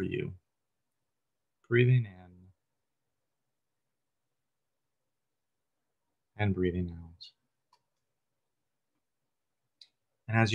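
calm, unhurried meditation guide, long pauses between phrases; guided meditation. Real, not acted. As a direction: gently lead listeners through breath and body, lingering on phrase ends.

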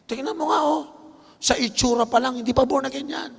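high mocking demon voice; mocking devil. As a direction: sneer like a devil whispering doubt. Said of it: "Pipilitin pa ng demonyong".

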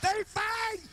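high, loud shouted announcement. Retold or imitated. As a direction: yell it out to the whole block.

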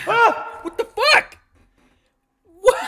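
high, loud laughing shock; a yelp that breaks into laughter; startled laugh. Real, not acted. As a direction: jump and laugh at the scare. Startled by character in restroom.

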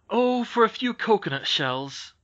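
put-on a boy's drawn-out wistful wish; wistful sigh. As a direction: yearn for what they lack. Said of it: "sighed Ernest".

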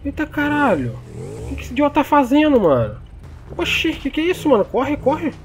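high panicked yelling; frantic, urging someone to run; being chased. Acted. Car thief chase, urging to run.

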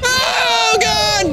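high, loud cry of alarm; panicked cry. Real, not acted. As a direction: cry out as the monster closes in. Trapped by monster, cries out.

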